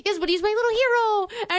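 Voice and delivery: high doting coo through laughter; laughing baby talk. Retold or imitated. Owner defending her dog to her boyfriend, laughing and high. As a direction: laugh while cooing it. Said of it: "she answers her boyfriend".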